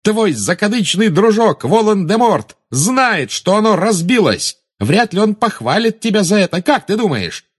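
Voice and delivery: Harry mocking Bellatrix about her master; taunting. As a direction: rub it in, savouring her fear.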